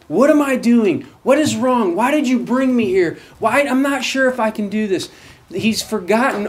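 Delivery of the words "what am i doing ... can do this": higher, louder desperate plea; preacher voicing Joshua's frantic plea; pleading desperately. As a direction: cry out to God in anguish. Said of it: "pleading out to God".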